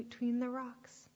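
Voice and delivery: plain reading voice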